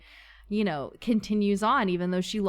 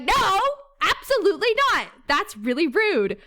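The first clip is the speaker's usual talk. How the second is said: mock serious protest; 11 semitones higher, 5 dB louder than usual. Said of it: "But I'm very serious".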